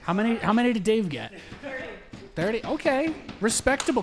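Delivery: high, loud grandiose commentator; grand announcer. Acted. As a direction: hail the athletes with mock pomp. Mock pompous post-match speech.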